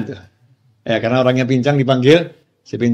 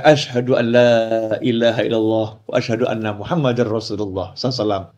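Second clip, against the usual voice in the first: recited shahada; 3 semitones lower, 4 dB quieter than usual. Retold or imitated.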